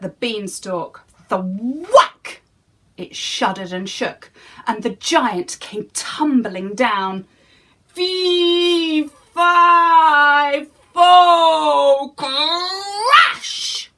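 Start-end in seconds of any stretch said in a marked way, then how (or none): 1.3-2.4 whack. A narrator's reading. Axe hits beanstalk. 7.9-13.9 higher, loud cut-off roar; falling giant. Acted. Giant tumbling down the beanstalk, roar cut off.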